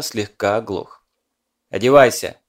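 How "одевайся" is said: loud barked order; shouting. A narrator's reading. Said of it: "проорал ещё раз".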